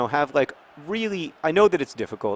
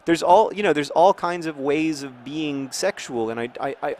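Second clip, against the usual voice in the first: ordinary talk, nothing marked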